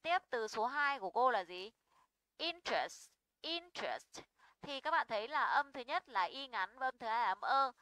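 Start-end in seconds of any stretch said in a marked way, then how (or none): 2.4-3.1 "Interest" stressed on IN, falling away after; first-syllable stress. Shown as a demonstration. Teacher models word for students, first-syllable stress. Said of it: "trọng âm sẽ rơi vào âm số một". 3.4-4.2 "interest" with a high stressed IN, then a fall; first-syllable stress. Shown as a demonstration. Teacher repeats example word, first-syllable stress. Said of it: "trọng âm sẽ rơi vào âm số một".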